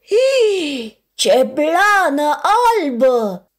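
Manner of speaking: loud, slow, marveling with a laugh; high delighted wonder with a giggle. Acted. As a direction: gasp in delight at the fur. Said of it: "se minună al doilea".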